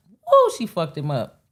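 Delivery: loud, delighted whoop at a roast; hyped whoop. Real, not acted. Streamer whooping at the roast, loud and delighted. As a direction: cheer it out loud and thrilled.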